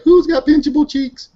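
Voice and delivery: put-on high squealing baby talk; squealing baby talk aunt. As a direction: coo like a doting aunt pinching cheeks. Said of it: "I was like".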